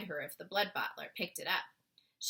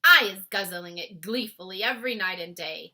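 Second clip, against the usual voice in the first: loud hearty giant voice; 11 dB louder than usual. Acted. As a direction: boast it cheerfully. BFG giant voice.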